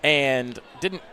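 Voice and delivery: energetic live game call, building on shots; play-by-play. Acted. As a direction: call each move as it happens.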